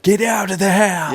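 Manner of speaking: high-pitched mock movie-heckling yell; yelling at the screen. Horror fan to ghost movie characters, high mock yell. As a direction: yell a panicked warning at a film character. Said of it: "yelling at the screen".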